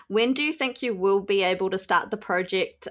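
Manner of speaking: ordinary talk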